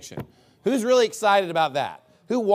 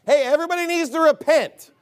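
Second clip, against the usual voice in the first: proclaiming; 9 semitones higher, 5 dB louder than usual. Acted. Preacher proclaiming God's command.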